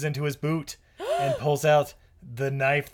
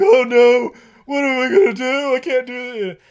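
high, loud mock panic; hammed-up despair, near tears; panicked wail; 8 semitones higher, 9 dB louder than usual. Hero panicking, acted.

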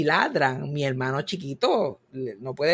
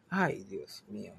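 exhausted sigh; 9 semitones lower, 11 dB quieter, slower than usual. Real, not acted. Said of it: "worn out by barking".

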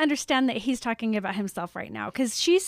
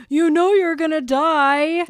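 slow, louder blunt delivery; mocking a rude friend's bluntness; 4 semitones higher, 6 dB louder, slower than usual. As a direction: say it flatly cruel, dragged out. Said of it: "I wouldn't be like".

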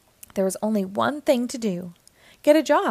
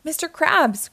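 higher, louder happy call; delighted cry; 7 semitones higher, 4 dB louder than usual. Acted. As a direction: call out, gleeful. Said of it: "cried Squidward with delight".

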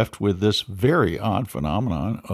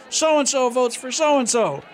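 county clerk announcement; 12 semitones higher, slower than usual. Retold or imitated. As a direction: announce the vote to the crowd. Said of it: "the county clerk is gonna say".

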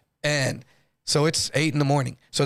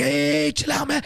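pleading; 11 semitones higher, slower than usual. Retold or imitated. Cellmate pleading to calm knife attackers.

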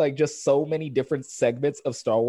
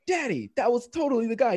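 put-on high child's voice; imitating a little girl; tattling daughter; 10 semitones higher than usual. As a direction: pipe up like an insistent little kid. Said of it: "then the daughter's like".

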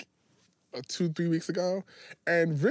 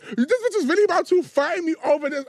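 incredulous; 10 semitones higher, 6 dB louder than usual. Retold or imitated. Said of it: "I said".

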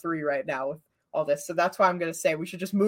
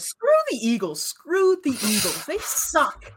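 high-pitched mock fury at the Eagles; hammed-up fan outrage, pitched up high; mock angry rant; 8 semitones higher, slower than usual. Fan venting at rival team.

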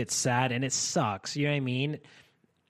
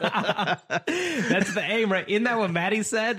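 talking through real laughter, pitched up; words bubbling with amused laughter; laughing; 7 semitones higher, slower than usual. Real, not acted. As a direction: laugh it out while you speak. Laughing at the dilf joke.

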